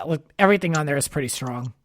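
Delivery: ordinary talk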